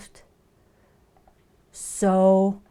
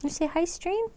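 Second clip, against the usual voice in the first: over-sweet coaxing question; pet talk; 13 semitones higher than usual. Real, not acted. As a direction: go up another notch for the cat. Prompting cat to greet chat.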